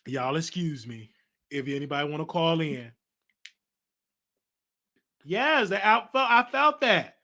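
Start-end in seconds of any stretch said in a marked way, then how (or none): 5.2-7.2 high and loud, really feeling it; emotional rise. Real, not acted. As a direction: swept up in the moment, slow on felt that. Moved by viewers' donations.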